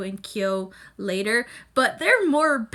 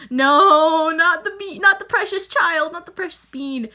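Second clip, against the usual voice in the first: put-on higher, louder pleading no, not the child; mock anguished wail; 5 semitones higher, 7 dB louder than usual. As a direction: wail it like a fan begging the show to stop. Mock panic at scene, imitated self.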